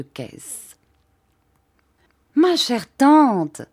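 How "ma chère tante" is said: high, loud and drawn out in happy surprise; glad cry. A narrator's reading. The narrator greets his aunt with a glad, drawn-out cry. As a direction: cry out with delight at the unexpected visit. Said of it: "m'écriai-je".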